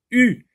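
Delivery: U alone, high and loud; son isolé. Shown as a demonstration. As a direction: punch out the single vowel U at high pitch. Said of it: "commence par U". (« u » isolé, aigu et fort)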